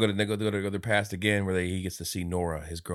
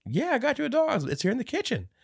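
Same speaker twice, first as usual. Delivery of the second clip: very high-pitched mock mom; mom impression. Mom telling her child about the dog, high mock voice.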